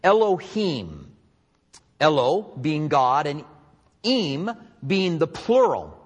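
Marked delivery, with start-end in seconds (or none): 0.0-1.1 Elohim said slowly, eh-loh-HEEM; slow careful Elohim; Hebrew word Elohim. Shown as a demonstration. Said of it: "the word is".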